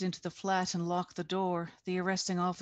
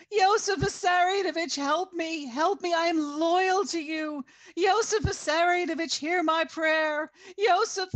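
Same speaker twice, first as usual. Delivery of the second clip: desperate pleading; 11 semitones higher, 7 dB louder than usual. A narrator's reading. Arrested woman begs Stalin.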